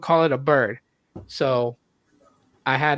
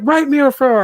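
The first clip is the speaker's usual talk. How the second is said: defiant; 8 semitones higher, 5 dB louder, faster than usual. Retold or imitated. Defiant retort to teacher.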